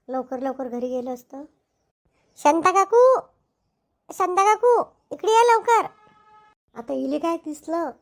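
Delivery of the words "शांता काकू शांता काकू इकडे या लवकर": loud, high shout for someone far off; urgent calling out. Acted. Calling Shanta aunty to come quickly, shouting. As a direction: holler to summon help quickly. Calls aunt over from afar.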